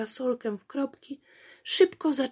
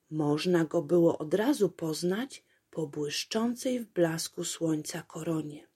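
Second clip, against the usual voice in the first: plain reading voice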